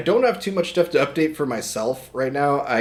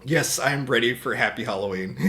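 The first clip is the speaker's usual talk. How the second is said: usual speaking voice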